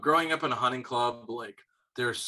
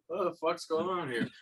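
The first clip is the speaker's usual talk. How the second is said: higher-pitched puzzled animal voice; bewildered buck; 5 semitones higher, 4 dB quieter than usual. Retold or imitated. Buck looking around mid-gunfire, lightly voiced bewilderment. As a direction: lightly voice a confused deer.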